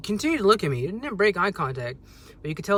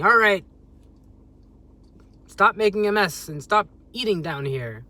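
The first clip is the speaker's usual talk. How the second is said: put-on much louder, bossy order to the kids; dad scolding the children; yelling; 4 semitones higher, 6 dB louder than usual. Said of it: "yelling at everybody in the room".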